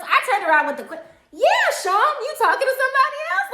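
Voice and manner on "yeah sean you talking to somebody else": very high, loud, rushed pile-on; squealed teasing interrogation; loud teasing echo. Retold or imitated. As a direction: pile on with a mock-accusing shout. Piling on the teasing question.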